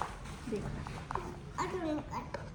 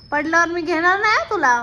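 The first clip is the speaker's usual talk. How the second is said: loud warning; 3 semitones higher, 9 dB louder than usual. Real, not acted. Warning child.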